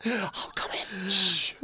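slow, hushed playful welcome; mock secretive whisper. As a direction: usher them in under your breath. Plays along with the secret visitor.

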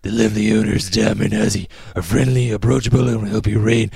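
steady, measured announcer-style ad read; podcast host reading sponsor copy. Acted. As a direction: read the copy evenly, selling to listeners. Reads the sponsor ad.

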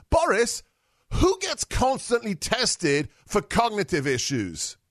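usual speaking voice

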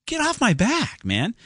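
high-pitched, indignant brush-off; exasperated retort. Acted. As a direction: push them away, pitched right up. Snaps back at imagined pushy ad.

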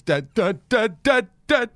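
put-on a teen nagging her dad on the plane; whiny daughter. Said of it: "She goes".

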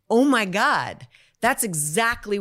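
loud, high burst of recognition; retold shock. Actress hearing her own experience on the news, loudly astonished.

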